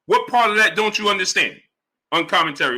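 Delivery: ordinary delivery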